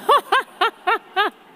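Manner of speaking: high loud laugh breaking out; real laugh; burst of laughter. Real, not acted. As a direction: laugh hard at the memory. Laughs at her own story.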